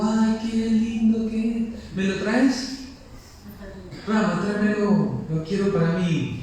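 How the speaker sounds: put-on high, slow and sing-song, like an enchanted girl begging for a pretty thing; Sita pleading sweetly. Sita coaxes Rama to bring her the golden deer, high and sweetly pleading. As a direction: stretch it out, coaxing and high.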